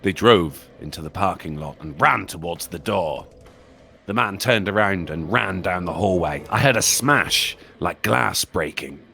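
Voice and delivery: low, hushed horror narration; creepy story narration. Acted. Streamer reading a creepypasta to chat, hushed and ominous. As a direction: spin a scary tale for the viewers.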